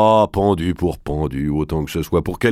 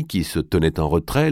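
plain reading voice

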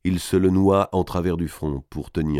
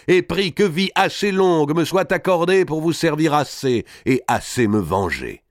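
fervent lord; 4 semitones higher than usual. Acted. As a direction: proclaim a solemn vow to the whole hall. Voices Robert's solemn vow at banquet.

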